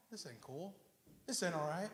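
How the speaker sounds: low, very soft complaint; quiet grumble. Acted. Believer envying others' prosperity, low quiet grumble. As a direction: keep the protest down low.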